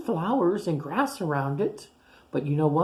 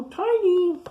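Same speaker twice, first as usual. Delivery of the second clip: high surprised greeting; 14 semitones higher, 5 dB louder than usual. Acted. Pointy answers Tiny.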